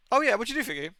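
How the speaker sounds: normal talking voice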